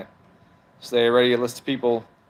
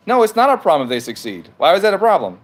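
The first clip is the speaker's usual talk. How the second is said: raised, higher-pitched argumentative voice; exasperated yelling; 6 semitones higher, 3 dB louder than usual. Real, not acted. Streamer arguing with a commenter about success rates, yelling in exasperation. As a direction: argue back loudly, pitch climbing into the question. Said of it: "Why are you yelling?".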